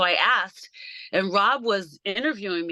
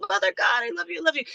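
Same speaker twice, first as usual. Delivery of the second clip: retold tearful gush; 8 semitones higher, much faster than usual. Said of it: "I started crying".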